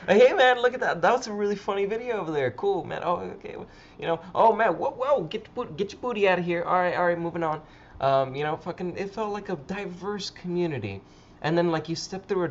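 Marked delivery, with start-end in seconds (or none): 0.1-5.2 high, excited calling out; acted party hollering. Acting out greeting people at a crowded Instagram party, excited hollering. 5.2-7.6 raised pitch, playful shooing; mock party banter. Acting out moving through a crowded Instagram party, playful banter.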